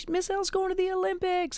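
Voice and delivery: put-on high, giddy kids' voice, bursting with the news; imitating excited students. Students telling teachers her news, high and giddy. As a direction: squeal it out like thrilled ninth graders.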